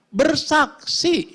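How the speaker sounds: Bersaksi stretched slowly, high and loud; drawn-out Bersaksi. Shown as a demonstration. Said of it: "drawn-out emphatic call". (Bersaksi diucapkan panjang, tinggi dan lantang)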